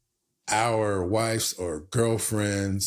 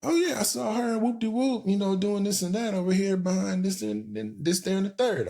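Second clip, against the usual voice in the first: gossiping buddy; 12 semitones higher than usual. Retold or imitated. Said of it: "He's like".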